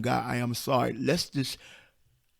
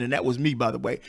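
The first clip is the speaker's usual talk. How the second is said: ordinary talk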